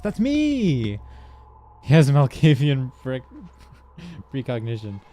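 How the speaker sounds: high, loud excited outburst. Real, not acted. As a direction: blurt it out thrilled. Excited self-recognition.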